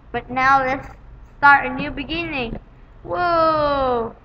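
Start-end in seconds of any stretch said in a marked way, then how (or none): none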